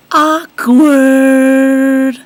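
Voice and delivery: high shouted "Awkward!"; loud awkward. Acted. Comic aside after rabbit story.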